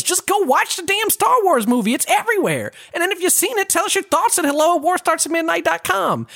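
high-pitched exasperated ranting; worked up and incredulous. Real, not acted. Exasperated rant at co-host.

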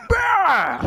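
very high, loud barked "Bah!"; mock shout. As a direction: blast it like a shouting host. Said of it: "the second syllable becomes like".

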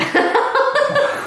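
high squealing laugh; burst of laughter. Real, not acted. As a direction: laugh out, delighted and caught off guard. Laughing at friend's ultimatum.

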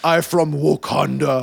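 slow, mock African accent; hammed-up African accent; obnoxiously African accent. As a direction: drag it out in an exaggerated African accent. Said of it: "this obnoxiously African accent".

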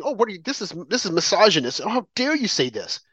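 mock-offended quote; put-on indignation of the offended. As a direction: imitate scandalized objectors, pitched a little high. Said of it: "people were offended".

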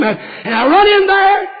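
high, loud and slow; preaching shout. Acted. Preacher shouting his story to the congregation, high and loud. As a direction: shout it out to the pews.

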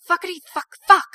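loud, high string of curses; frantic swearing. A narrator's reading. Victoria panicking over the unconscious skinhead, loud and high. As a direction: let it burst out in panic.

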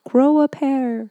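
half-yell it at the song's singer; mock yell. Co-host heckles a love song, playful mock yell. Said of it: "I want to yell".

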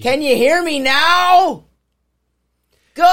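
high, drawn-out phone shout. Acted. Mimicking marketing ads about phone calls, shouting. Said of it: "That's because I hear you shouting".

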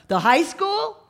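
high questioning call through intercom. Acted. Man on intercom checks polling place, calling out. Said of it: "the guy from the speaker calls out".

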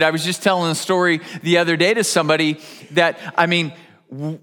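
ordinary talk, nothing marked

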